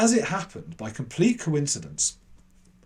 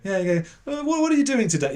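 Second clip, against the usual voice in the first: much higher, louder, overeager; eager kid caller; 10 semitones higher, 5 dB louder than usual. Retold or imitated. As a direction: cover the awkwardness with bright eagerness. Kid asks second question.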